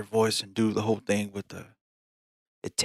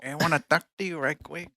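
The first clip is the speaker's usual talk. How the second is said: higher-pitched mock Mexican accent; paisa accent; 6 semitones higher, 3 dB quieter than usual. Echoing friend's flirting, playful Mexican paisa accent.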